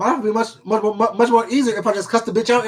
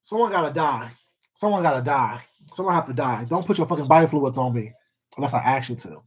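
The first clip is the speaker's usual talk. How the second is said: low menacing; 6 semitones lower, 5 dB quieter than usual. Real, not acted. Threatening over spit story.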